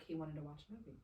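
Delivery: ordinary talk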